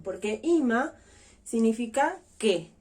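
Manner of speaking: ordinary talk, nothing marked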